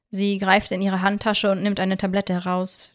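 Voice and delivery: ordinary delivery, nothing marked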